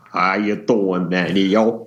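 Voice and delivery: a lightly put-on New York accent, mock tourist; bad New York accent. Podcaster mocking how he'd fake a New York accent. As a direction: greet the city in a hammed-up New York accent. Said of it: "What does New York sound like".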